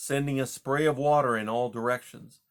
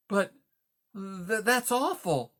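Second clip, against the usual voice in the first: dismayed mouse; 9 semitones higher, slower than usual. Acted. Mouse learns Rye is caged.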